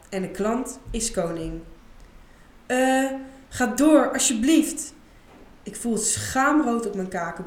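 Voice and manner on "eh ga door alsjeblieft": slow, higher, louder halting plea; hesitant plea. Acted. Isa hesitantly begs Paul to continue, slow embarrassed plea. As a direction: stretch it out, unsure how to beg.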